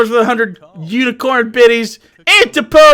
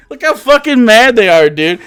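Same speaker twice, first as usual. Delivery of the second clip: ordinary delivery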